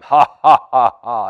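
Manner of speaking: loud booming mock laugh; Goliath's booming laugh at the armies; terrible giant laugh. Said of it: "he laughed his terrible laugh".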